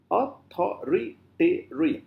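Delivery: "authoritarian" slowly broken into spaced syllables. Shown as a demonstration. Teacher counting six syllables, slow syllable by syllable. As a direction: count out every beat of "authoritarian". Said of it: "So we have six syllables".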